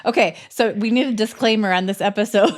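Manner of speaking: nervous laugh through words. Real, not acted.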